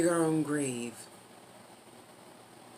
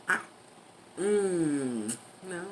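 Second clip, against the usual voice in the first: ordinary talk, nothing marked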